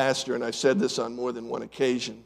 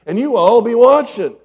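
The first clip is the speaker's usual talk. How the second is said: emphatic loud; 7 semitones higher, 11 dB louder than usual. Acted. Dramatic judgment day image.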